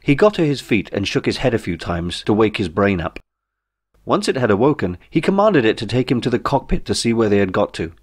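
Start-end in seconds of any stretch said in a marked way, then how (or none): none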